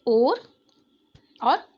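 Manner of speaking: ओर and और said slowly, full vowel on each; clear vowel demo. Shown as a demonstration. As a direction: sound out और carefully for children. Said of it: "पहला शब्द है".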